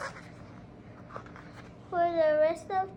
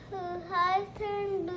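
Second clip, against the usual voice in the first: usual speaking voice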